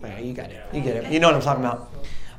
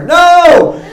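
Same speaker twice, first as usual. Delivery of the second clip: loud, high yelled no; hammed-up final refusal; arguing with God; 13 semitones higher, 11 dB louder than usual. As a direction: yell the refusal high and hard. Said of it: "That's how I talked to God".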